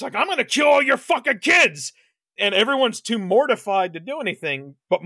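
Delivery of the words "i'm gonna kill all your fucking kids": high, loud imitation of a villain's threat; retold shout. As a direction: shout it out as the burning killer threatening parents. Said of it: "is shouting to the parents".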